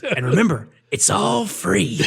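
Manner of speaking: high, very slow grand offer; Brickma voice. Retold or imitated. Retelling Brickma showing the pinball machine slowly. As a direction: draw out free slowly and high.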